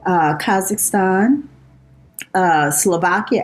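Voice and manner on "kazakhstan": ordinary talk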